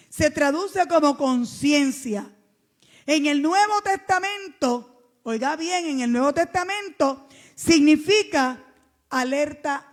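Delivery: loud, slow, drawn-out teaching from the pulpit; emphatic preaching. Acted. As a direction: drive each word home to the church. Preaching, explaining Greek word.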